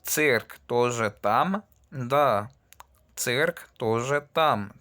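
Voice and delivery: a slow question then a slow answer; slow model dialogue. Shown as a demonstration. Teacher voices both sides of a dialogue slowly. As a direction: spell out the exchange slowly. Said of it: "Ya hacemos ejemplo conocido".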